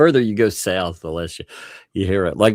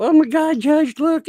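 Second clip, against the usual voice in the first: much higher, hammed-up pleading wail; mock a whiny victim begging the judge, pitched way up; mock victim whine; 5 dB louder than usual. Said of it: "they try to play victim".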